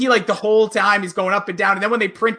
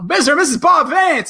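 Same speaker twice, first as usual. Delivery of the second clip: announcing loudly; 7 semitones higher, 5 dB louder than usual. Retold or imitated. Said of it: "but when he says".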